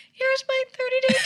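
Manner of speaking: very high nervous offer; high anxious voice to the cop. Retold or imitated. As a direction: squeak it out anxiously. Nervously handing over tags.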